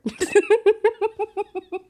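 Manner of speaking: high, loud burst of real laughter. Real, not acted. Reviewer to viewers, laughing out loud at the book. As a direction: crack up at how dumb the scene is.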